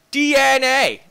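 high, loud burst; excited exclamation. Real, not acted. Player spotting what they need, excited exclamation. Sudden exclamation while playing game.

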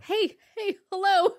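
high, loud mock call for attention; acted-out urgent hello. Calling to catch the sheriff's attention.

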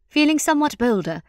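plain reading voice

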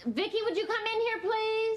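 high-pitched call to another room. Acted. Mother summons her daughter to meet a visitor, calling out.

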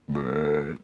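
low soft croaky bleh; frog croak. Shown as a demonstration. Frog sound in the animal list.

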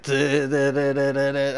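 low, repeated stuttering "duh"; imitated glitch stutter. Gamer mimics the glitching player, low stutter. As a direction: rattle it off low like a stuck sound. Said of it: "and it's like".

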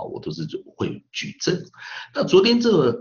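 ordinary talk, nothing marked

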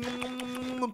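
soft nom-nom eating noises; munch playfully, under your voice; pretend munching. Mimes eating çiğ köfte. (yemek yeme taklidi)

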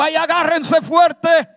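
high, loud, slow mock cry of alarm; hammed-up cry to hold on tight; mock panic. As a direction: play a panicked passenger, hammed up. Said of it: "Jesús no dijo".

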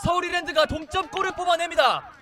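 high, loud goal call; excited goal call. Acted.

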